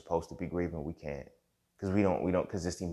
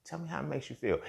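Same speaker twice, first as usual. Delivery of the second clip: much higher, gentle mock-therapist question; 11 semitones higher than usual. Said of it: "on some therapist, psychologist type shit".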